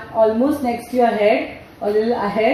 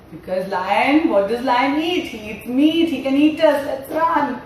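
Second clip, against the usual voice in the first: usual speaking voice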